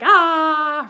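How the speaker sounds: loud, high open-mouthed "aah"; excited "ah". Shown as a demonstration. Teacher showing an excited mouth, excited cry. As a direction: burst out with a delighted cry. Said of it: "if I'm excited".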